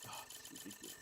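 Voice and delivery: breathy, barely voiced groan of disbelief; exasperated whisper. Real, not acted. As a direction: let it sag out under your breath. Exasperated at bad crate luck.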